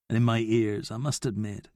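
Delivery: plain reading voice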